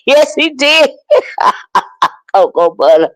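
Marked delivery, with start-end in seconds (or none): 0.1-1.5 laughing. Real, not acted. Laughing retelling.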